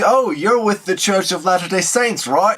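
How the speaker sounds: the Australian operator, fishing for a yes; mock Australian accent. Scam operator asking about the church, mock Australian accent. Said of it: "he's got this Australian accent".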